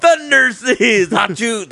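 high, stretched-out hero shout; mock heroic shout. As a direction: announce the hero like a cartoon.

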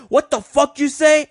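high, loud, drawn-out angry yell; mock drunk woman yelling. Storyteller voicing the drunk woman who slapped him, loud. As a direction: shriek it like a drunk stranger picking a fight.

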